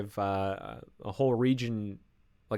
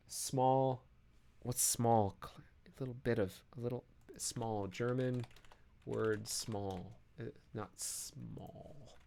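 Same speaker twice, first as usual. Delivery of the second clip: very soft, half-voiced thinking aloud; under the breath, absently; muttering to self; 8 dB quieter, slower than usual. Real, not acted. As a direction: mumble while hunting for a forgotten word. Searching to self for a word.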